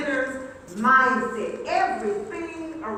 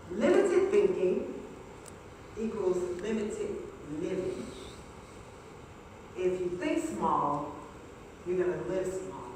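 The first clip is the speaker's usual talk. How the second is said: quiet dramatic; 8 semitones lower, 7 dB quieter than usual. Acted. Slow quiet dramatic delivery.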